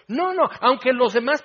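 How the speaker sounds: insistent protest. Retold or imitated. Peter insisting to Jesus, loud stubborn protest. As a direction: push back like stubborn Peter.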